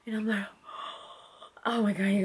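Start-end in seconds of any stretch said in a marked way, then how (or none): 0.5-1.1 short soft gasp of surprise; quiet retold gasp; acted gasp. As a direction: mimic catching your breath at news. Gasp acted on hearing news.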